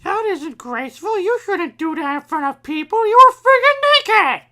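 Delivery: very high, hammed-up scolding building to a yell; mock outraged scolding. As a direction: scold in a high-pitched cartoon voice, ending loud. Acted scolding in a retold scene.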